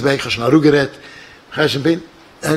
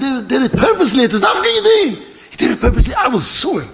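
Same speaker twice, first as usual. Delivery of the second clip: high, worked-up acted complaint; outraged accusation; 8 semitones higher than usual. Passenger accusing the pilot of sabotage, acted outrage. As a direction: play an indignant customer threatening action.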